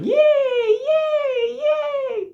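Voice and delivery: tiny squealing falsetto cheers; very high, drawn-out mock yays; cheering. Birds and animals cheering for Tiger Woods, squeaky falsetto cheer. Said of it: "and they're cheering".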